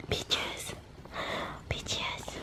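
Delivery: soft whispered name. Real, not acted. Owner whispers her dog's name softly. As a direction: breathe the name in a hush.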